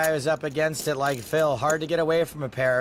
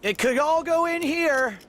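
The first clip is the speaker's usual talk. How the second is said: excited; 14 semitones higher than usual. Real, not acted. Commentator builds excitement.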